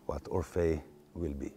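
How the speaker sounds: usual speaking voice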